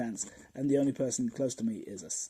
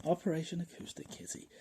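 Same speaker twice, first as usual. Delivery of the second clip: softer, slightly higher title repeat; understated repeat; 4 semitones higher, 6 dB quieter than usual. Real, not acted. Comedian savours the absurd title, understated repeat. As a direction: say the title again, quietly savouring it.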